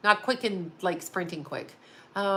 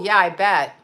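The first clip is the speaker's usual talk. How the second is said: usual speaking voice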